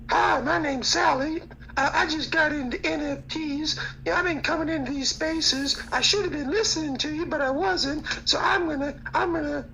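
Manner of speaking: high falsetto mock of a clueless newcomer; high mock newbie voice; mocking Sally. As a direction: squeak it out like a clueless girl.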